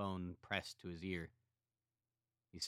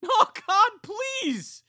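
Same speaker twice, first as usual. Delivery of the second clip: very high, loud terrified plea; terrified cry; 20 dB louder, much slower than usual. A narrator's reading. Katie begging her brother to stop, terrified. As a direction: scream for it to stop. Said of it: "Katie cried".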